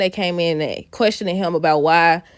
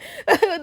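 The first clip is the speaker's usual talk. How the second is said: laugh; 11 semitones higher than usual. Real, not acted. Laughing at the soap plot.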